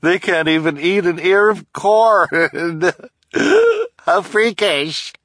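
mock them while cackling; cackling taunt. Woman laughing cruelly.

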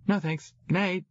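put-on high-pitched, quick brush-off from a young girl; dismissive little sister. A narrator's reading. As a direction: send mother away politely. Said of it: "trying to get rid of her".

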